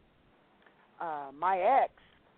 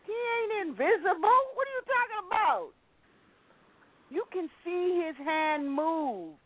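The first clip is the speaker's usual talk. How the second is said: indignant protest; 10 semitones higher than usual. Retold or imitated. Defending her faith to ex.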